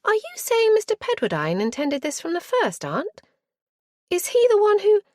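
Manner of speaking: eager interruption. A narrator's reading. Niece blurting a question before she's silenced, eager. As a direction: rush it out and cut off.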